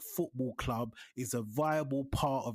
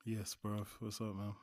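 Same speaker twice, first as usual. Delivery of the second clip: deflated greeting; 6 semitones lower, 10 dB quieter than usual. Real, not acted. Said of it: "You can sound a little bit more lively than that".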